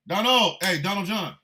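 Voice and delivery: acted street-style challenge; calling someone out. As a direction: shout for his attention, spoiling for a fight. Acts calling Trump out to fight.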